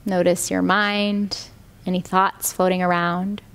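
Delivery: calm, even meditation voice with long pauses; guided meditation. Real, not acted. Yoga teacher guiding students lying down into rest, meditative.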